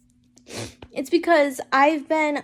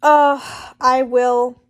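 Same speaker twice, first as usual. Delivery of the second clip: ordinary delivery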